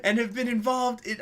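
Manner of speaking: put-on high, loud German-accented rant; hammed-up furious German voice; mock German accent. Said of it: "I'm not gonna do the accent".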